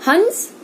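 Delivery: हंस with a nasal vowel, pitched high; हंस, rising high, nasal vowel; careful hans. Shown as a demonstration. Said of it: "इसका बार बार उच्चारण करना है".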